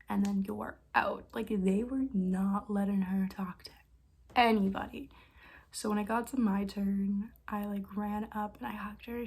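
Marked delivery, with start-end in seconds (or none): none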